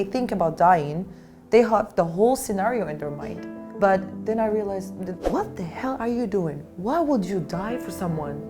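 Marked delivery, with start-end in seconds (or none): none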